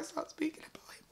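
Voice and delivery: whispered, choking up; emotional whisper. Real, not acted. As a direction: whisper as tears rise. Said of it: "No, we stay strong".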